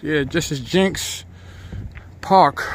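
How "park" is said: ordinary talk, nothing marked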